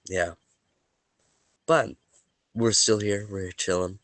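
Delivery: usual speaking voice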